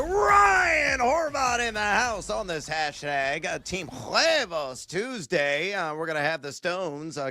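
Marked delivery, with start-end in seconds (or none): 0.0-3.4 loud, energetic show-host welcome; hyped show announcer. Acted. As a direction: boom it out like a radio host opening.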